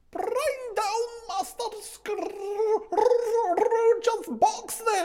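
very high, slow, laughing shriek; terrible voice. Acted. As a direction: screech it like a mad schoolmaster. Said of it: "A terrible voice in the hall cried".

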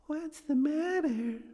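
high, slow otherworldly voice; eerie high ghost asking gently; ghostly spirit. Acted. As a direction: float it up like a spirit. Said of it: "asked the spirit".

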